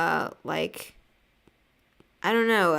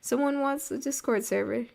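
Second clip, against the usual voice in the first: much higher, delighted wow; excited surprise; 8 semitones higher than usual. Retold or imitated. Recalling a viewer's Discord question, delighted and high. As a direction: recall the happy surprise, pitched up. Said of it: "'cause I was like".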